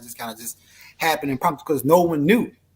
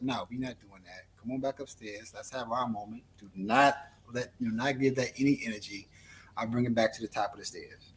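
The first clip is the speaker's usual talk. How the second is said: hushed calming; 3 semitones lower, 7 dB quieter than usual. Retold or imitated.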